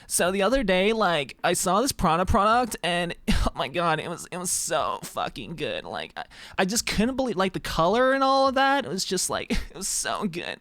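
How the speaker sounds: mock gushing girl about to burst into tears; verge of tears. Streamer mimics girls raving about a Prada product, on the verge of tears. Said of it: "be on the verge of tears".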